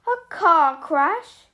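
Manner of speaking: huge slow roar of outrage. A narrator's reading. Hagrid roars at the Dursleys, slow and furious. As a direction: bellow it, stretching each word. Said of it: "roared Hagrid".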